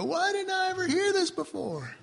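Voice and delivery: high-pitched newcomer's protest; mock incredulous. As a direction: act out a puzzled complaint. Acting out puzzled newcomer's question.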